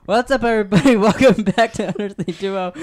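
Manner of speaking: loud, high, upbeat welcome; podcast host intro. Acted. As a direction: hype up the listeners. Opening the podcast episode.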